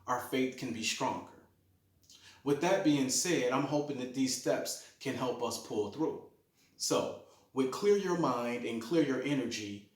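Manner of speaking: normal talking voice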